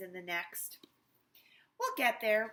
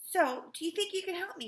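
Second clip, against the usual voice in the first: normal woman's voice; 9 semitones higher, 6 dB louder than usual. Acted. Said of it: "in her normal voice".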